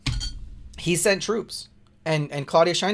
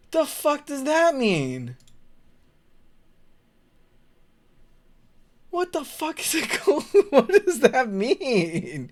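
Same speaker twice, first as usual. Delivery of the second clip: baffled high pitch; 11 semitones higher, 4 dB louder, slower than usual. Real, not acted. Baffled by unknown word.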